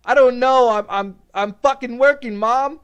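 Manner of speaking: hyped reply. Retold or imitated. Answering mom while high on pills, loud and laughing. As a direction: blurt it out, still pumped.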